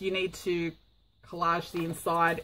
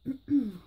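throat clear from smoke; 5 semitones higher, 3 dB quieter than usual. Real, not acted. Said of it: "It's been killing me".

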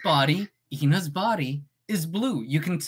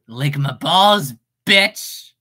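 lightly voiced Oswald, louder and slow; 4 semitones lower, 7 dB louder, much slower than usual. Viewer request in the Oswald voice, slow and louder. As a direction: drag it out slow and cocky. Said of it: "in the Oswald voice".

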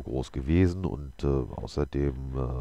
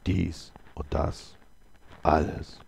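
very slow, each phrase set apart; deliberate stranger; slower than usual. A narrator's reading. Stranger tells the holy man what he means, slow and measured. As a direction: drop the words slowly, one by one.

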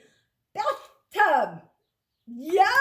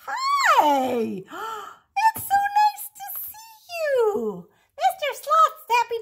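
much higher, bright hello; excited greeting; 10 semitones higher, 4 dB louder than usual. Real, not acted. Greeting child on video call.

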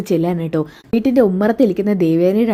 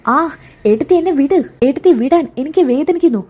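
crying out in pain; 7 semitones higher than usual. Acted. Jalaja dragged by her sister-in-law, pained pleading cries. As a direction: yelp as your arm is wrenched.

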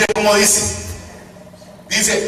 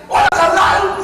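mock street greeting; 8 semitones higher than usual. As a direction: greet him like a homie on the street. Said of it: "unos hermanos así se dicen".

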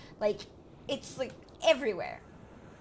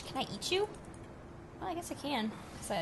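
ordinary delivery

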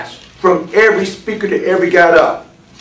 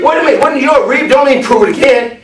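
high, raised preacher's voice; forceful preaching; 8 semitones higher, 4 dB louder than usual. Real, not acted. Preacher stops the reader to expound the verse, forceful preaching. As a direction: interrupt and drive the verse home loudly.

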